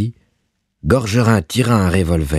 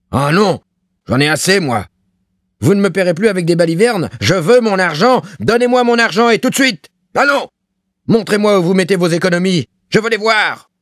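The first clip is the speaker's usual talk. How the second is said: furious high-pitched shouting tirade; terrible roar; 13 semitones higher, 4 dB louder than usual. A narrator's reading. Landlord demanding his money from a poor tenant, roaring furiously. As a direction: roar in rage, voice soaring higher and higher. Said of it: "se mit à rugir d'une voix terrible".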